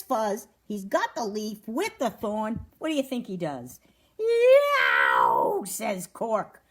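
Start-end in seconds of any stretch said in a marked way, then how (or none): 4.2-5.6 loud, drawn-out storybook yowl; pained yowl. A narrator's reading. Cork gets stuck by a thorn, loud acted yowl. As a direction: yell as if jabbed by a thorn.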